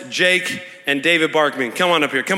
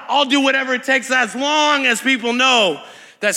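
raised, driving pulpit voice; impassioned preaching; 4 semitones higher than usual. Real, not acted. Preacher urges the church to pull on healing power, impassioned. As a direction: preach it high and insistent, hitting key words.

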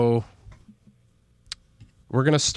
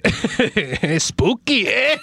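slow, higher, stretched mock Canadian; Canadian accent; 9 semitones higher, much slower than usual. Host exaggerates the accent after friend mocks it. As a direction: drag out spooky eh in a hammed-up Canadian voice. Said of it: "like, Canadian Mexican".

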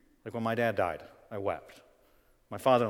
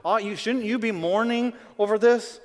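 high, loud urgent plea; urgent outcry; 10 semitones higher, 8 dB louder than usual. Retold or imitated. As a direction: call it out high and loud as Paul. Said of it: "He says".

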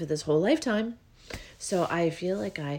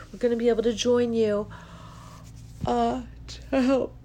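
yawning mid-sentence; talking through a yawn; 6 semitones higher than usual. Real, not acted. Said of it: "Oh, more releasing. Look at me".

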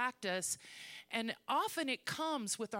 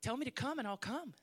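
higher, eager and quick; excited Peter; 4 semitones higher than usual. Acted. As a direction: sound thrilled and ready to jump in. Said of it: "Peter's like".

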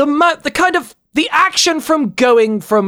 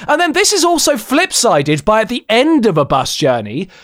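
ordinary talk, nothing marked

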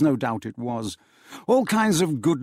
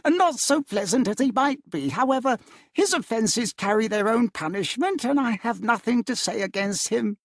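higher, light amused voice; good-natured nephew; 10 semitones higher than usual. A narrator's reading. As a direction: forgive him with a smile.

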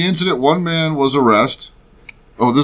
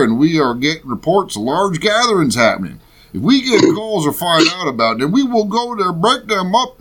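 mock Ohio accent, climbing higher as it goes; 4 semitones higher than usual. Host reads sheriff's warning about gatherings, in a put-on Ohio voice. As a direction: build up an indignant-sheriff Ohio voice. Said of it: "read it in the Ohio voice".

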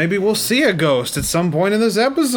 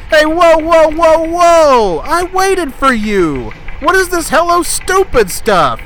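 shouting; 12 semitones higher, 6 dB louder, slower than usual. Acted. Host shouting at Ollie.

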